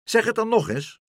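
high, loud demand; calling out. A narrator's reading. Meneer Pen tests flying Karel, calling out. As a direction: shout up for a repeat.

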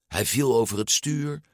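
ordinary delivery

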